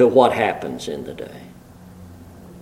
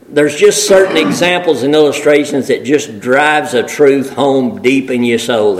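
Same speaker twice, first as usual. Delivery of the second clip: ordinary talk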